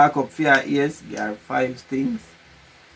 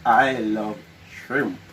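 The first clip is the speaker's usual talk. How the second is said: slow; 3 semitones lower, much slower than usual. Real, not acted. Slow exaggerated mouthing.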